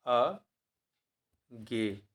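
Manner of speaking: अज्ञेय stretched very slowly, syllable by syllable; अज्ञेय spelled out in a long slow drawl; drawn-out dictation. Shown as a demonstration. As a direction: dictate अज्ञेय slowly, dwelling on each sound. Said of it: "फिर से लिखते हैं".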